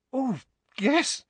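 high, breathless delight; delighted girl. Acted. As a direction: gush with happy surprise. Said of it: "blushing crimson and beaming".

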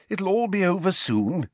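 high, encouraging reassurance; bracing. A narrator's reading. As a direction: buck him up. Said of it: "said bracingly".

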